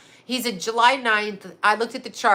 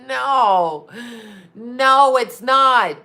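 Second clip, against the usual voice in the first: emphatic no; 2 semitones higher, 7 dB louder, much slower than usual. Real, not acted. Emphatic denial.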